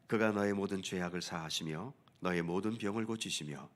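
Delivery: ordinary talk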